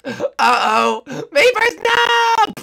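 real shocked scream as a friend falls; yelling no in real shock; anguished cry. Real, not acted. Friend about to fall.

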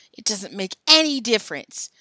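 loud, insistent yell. A narrator's reading. Joe argues with Billy over the bet, loud yell. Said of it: "Joe yelled at Billy".

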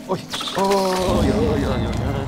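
loud, high repeated alarm cries; alarmed yelling. Real, not acted. Gamer caught by an enemy, loud alarmed cries. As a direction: yell out in a rush of alarm. Dodging enemies in panic.

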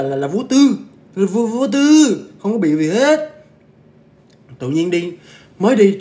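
high, loud, stretched-out boast; exaggerated storyteller's emphasis; emphatic hyping. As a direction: draw it out high to show how effortless it was. Hyping how effortlessly she climbed.

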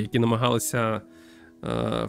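normal talking voice

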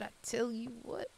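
high, soft Southern twang; Southern accent. Shown as a demonstration. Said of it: "a deep Southern accent".

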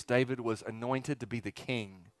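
ordinary talk

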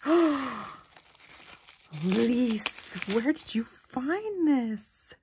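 gasp, then high, loud, drawn-out disbelief; real astonishment. Real, not acted. Gift recipient asking sender Elise about a rare find, astonished.

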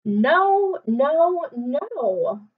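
high, loud repeated no; exasperated mom. Acted. Mom scolding David in the story. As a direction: scold with rising alarm.

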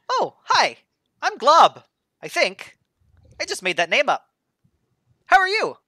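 high, bright-eyed newborn blob; high innocent ooze creature voice; high goofy blob voice. Acted. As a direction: chirp it like a friendly new creature.

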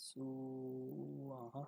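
সু আহা with a very long 'suuu' gliding into 'aaha'; slow Sanskrit pronunciation. Shown as a demonstration. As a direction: take your time over each vowel of সু আহা. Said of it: "একটু সময় নিয়ে এখানে বলতে হবে". (সু আহা, টেনে টেনে সংস্কৃত উচ্চারণে)

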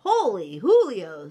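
loud, swooping kitten exclamation; a storybook squeal of amazement. Acted. Kitty boy seeing chihuahuas in mirror, squealing. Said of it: "squealed the kitty boy".

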